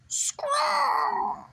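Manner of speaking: imitated giant bird shriek, very high and loud; bird screech. Recapping the episode, mimics the Moltres bird's very high screech. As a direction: screech like a huge swooping bird.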